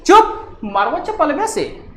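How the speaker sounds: sharp high shout on चुप, then the threat; ऊँची डाँट वाली चीख; angry scolding. Acted. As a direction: scold a naughty child, snapping. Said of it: "तो देखिए, चू अपा चुप".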